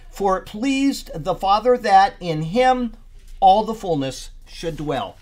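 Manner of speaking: emphatic preacher reading out a verse; scripture reading. Real, not acted. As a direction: proclaim the verse with weight, unhurried. Reads scripture verse aloud.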